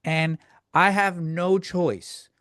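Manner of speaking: ordinary delivery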